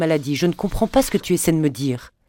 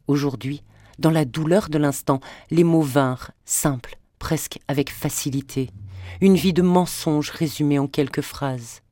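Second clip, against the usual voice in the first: ordinary delivery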